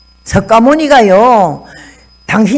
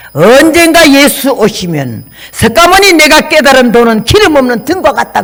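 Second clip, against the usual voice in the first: solemn quote; 4 semitones higher, 3 dB louder than usual. Retold or imitated. Quoting scripture solemnly.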